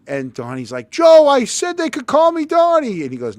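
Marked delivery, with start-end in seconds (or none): 0.9-3.0 much higher and louder, urgent pleading; pleading coach. Retold or imitated. Coach tries to calm the angry father, urgent high pleading. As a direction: beg him to let go.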